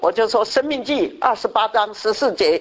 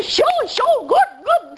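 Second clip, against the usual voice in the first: much higher mock eager audience reply; eager listener voice; slower than usual. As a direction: chirp it like thrilled listeners. (模仿听众，高声兴奋地应和)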